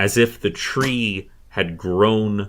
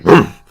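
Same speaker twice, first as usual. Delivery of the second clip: short, loud animal huff; sudden loud snorting woof; boar's warning snort; 5 semitones higher, 10 dB louder than usual. Retold or imitated. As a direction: burst out a brief boar woof. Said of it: "it kind of just gives you this".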